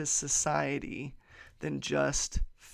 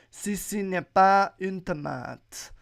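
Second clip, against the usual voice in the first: French pronunciation, louder and lilting; showy French; 3 semitones higher, 6 dB louder than usual. Acted. Podcaster repeats the French caption, louder showy French. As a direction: announce it proudly in French. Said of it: "The French".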